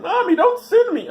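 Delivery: put-on high, shouted child's plea; crying kid. Child begging mother not to send him, high shout. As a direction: wail like a scared little kid. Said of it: "Kids crying".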